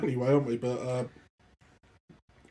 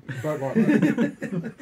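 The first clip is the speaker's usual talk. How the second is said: laughing; 3 semitones higher, 5 dB louder, much slower than usual. Real, not acted. Disgusted taster laughing at drink.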